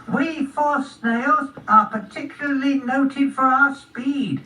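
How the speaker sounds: high, slow snail speech; unhurried little snail, pitched high. Acted. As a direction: explain it slowly as a tiny snail. Snail boasts of speed.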